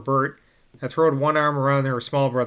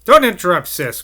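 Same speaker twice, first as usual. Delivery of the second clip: put-on loud, higher bossy girl; sharp cry; 4 semitones higher, 8 dB louder than usual. A narrator's reading. Nellie hushing Sis, loud and bossy. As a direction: scold her little sister. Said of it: "cried Nellie".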